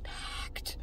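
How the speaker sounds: hushed whisper of the word; whispered emphasis. Real, not acted. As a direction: breathe it out under your breath. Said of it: "This place was packed".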